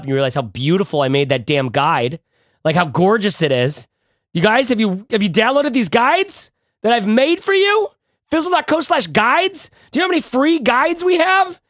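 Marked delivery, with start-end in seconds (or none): 4.3-11.6 high-pitched, strained mock exasperation at listeners; hammed-up wounded plea, pitched sky-high; mock-hurt pleading. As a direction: whine and beg, voice pushed way up high. Mock-pleading rant scolding listeners about guides.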